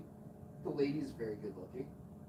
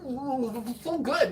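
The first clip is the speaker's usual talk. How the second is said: mock raving over food; 8 semitones higher, 8 dB louder than usual. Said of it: "There's this foamy area".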